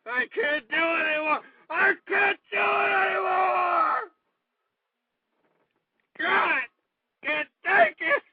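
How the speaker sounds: high, sobbing cry of despair; anguished, tearful high wail; wailing breakdown. Real, not acted. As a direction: wail it out, stretching the words. Host breaking down mid-rant.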